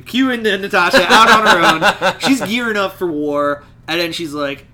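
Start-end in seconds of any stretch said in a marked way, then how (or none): 0.0-2.2 laughing redo. Real, not acted. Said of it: "God, this is a thick issue".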